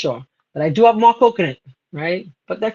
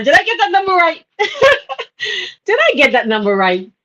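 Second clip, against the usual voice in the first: laughing question; 9 semitones higher than usual. Real, not acted. Laughing at own slip.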